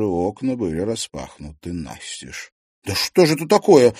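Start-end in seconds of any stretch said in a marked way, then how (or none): 2.8-3.9 high, loud outburst of anger; angry exclamation. A narrator's reading. Liza finds more windows flung open, angry exclamation. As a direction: burst out in wrath, pitched up and loud. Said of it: "гневно воскликнула она".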